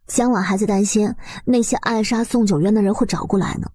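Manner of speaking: ordinary delivery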